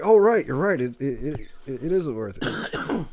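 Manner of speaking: high, loud start, slowing into stammered agreement; mock realization. Friend imagines a comic ad lib, mock realization. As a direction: pretend it just dawned on you, then stumble.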